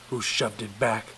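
plain reading voice

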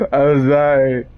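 sorry, said through a laugh; giggly, sheepish sorry; laughing apology. Real, not acted. Laughing apology after fleeing.

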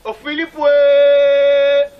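loud drawn-out cry of ouais; loud call. Acted. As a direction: call the name, then belt ouais. Ritual calling chant.

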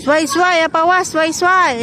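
high, loud calling out; calling from far away. Real, not acted. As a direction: call out across the park. Calling out to his pets.

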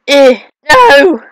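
loud, high cry of protest; dismayed shout. Real, not acted. Player failing a level, dismayed shout. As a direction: yelp then shout in dismay.

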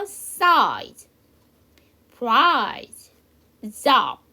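size, price, job each loud and separate; pronunciation model. Shown as a demonstration. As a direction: model size, price, job as isolated, emphatic words for learners to repeat. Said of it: "저랑 같이 한번 연습해봐요". (size, price, job을 따라 하도록 크게 또박또박)

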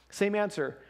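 ordinary delivery

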